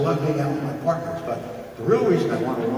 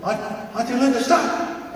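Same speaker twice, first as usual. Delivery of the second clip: put-on high child's cry; 13 semitones higher than usual. Child voiced in a story.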